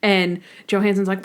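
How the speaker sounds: usual speaking voice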